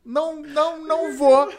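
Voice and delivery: high, laughing no no no; laughing refusal. Retold or imitated. Him refusing to go on stage, laughing high protest. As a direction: refuse while cracking up.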